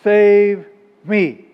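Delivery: mild read-aloud cry for help. A narrator's reading. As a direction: call out with drawn-out, firm words, as if retelling a plea. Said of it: "he cried out".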